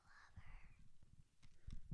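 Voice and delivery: whispering. Real, not acted. Child whispering affection.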